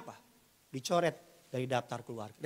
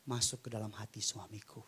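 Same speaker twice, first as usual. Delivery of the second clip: softly, trailing lower; soft, low murmured plea; gentle prayer; 3 semitones lower, 6 dB quieter than usual. Shown as a demonstration. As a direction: ask God gently for a spouse. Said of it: "Pelan-pelan".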